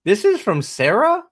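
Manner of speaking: high, loud disbelieving question; incredulous. Real, not acted. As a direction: sound stunned by the quote. Disbelief at quote.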